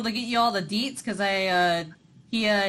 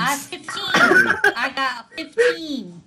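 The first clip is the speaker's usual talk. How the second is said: loud, slow, higher-pitched boast; excited crow; 5 semitones higher, 8 dB louder, slower than usual. Real, not acted. Player announcing a good history roll, loud and excited. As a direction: call out the roll loudly, stretched and gleeful.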